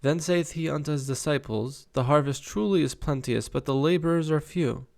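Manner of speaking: lifted, measured Bible read-aloud; scripture reading. Acted. Reader relaying Jesus's words to disciples, scripture reading. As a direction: read the saying out deliberately.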